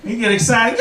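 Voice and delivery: ordinary talk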